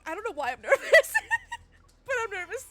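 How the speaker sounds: high, laughing through the words; nervous laughter. Real, not acted. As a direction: giggle out the nerves. Laughing about nerves.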